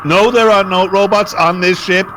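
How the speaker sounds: ordinary delivery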